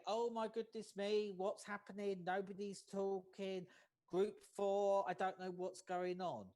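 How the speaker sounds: mock-panic over a silent group; panicked demo. Shown as a demonstration. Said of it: "I didn't say".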